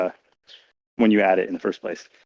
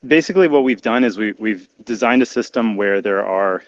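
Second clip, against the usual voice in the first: ordinary delivery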